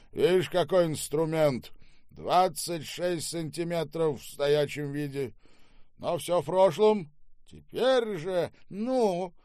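loud, high-pitched theatrical declaiming; coarse, bullying Штаубе. Acted. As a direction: hold forth like a boorish drunk master. (грубый, напористый Штаубе, с декламацией)

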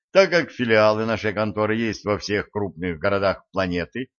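usual speaking voice

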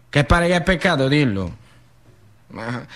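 ordinary talk, nothing marked

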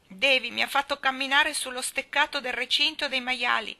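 put-on high, loud tearful little girl's wail; a small girl complaining through tears. A narrator's reading. Dora wailing to the ladies about Davy, tearful. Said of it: "gemette Dora".

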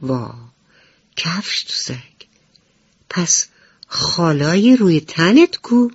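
puzzled and alarmed at once; surprised question. Acted. Butterfly asking the ladybug where her spots went, surprised. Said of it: "با تعجب پرسید".

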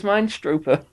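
Minestroper said as MINE-stroo-per; joke pronunciation. Shown as a demonstration. Co-host countering with a made-up word, Minestroper as MINE-strooper. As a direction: laughingly say Minestroper with long I. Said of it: "it's not a stro".

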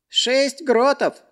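high, slow, insistent; servant naming price. Acted. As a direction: state the price, not budging.

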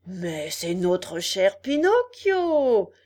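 loud, drawn-out cry of recognition; crying out in greeting. A narrator's reading. Fox greets his dear Pinocchio, crying out loudly. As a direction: stretch the welcome out and let it swell. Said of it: "cria le renard".

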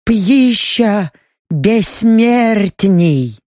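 very slow, awed elf voice; drawn-out reverent elf. Acted. Elf names the fruit.

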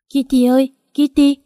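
rising desperate call. A narrator's reading. Mother calling her missing daughter, loud desperate call.

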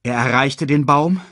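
usual speaking voice

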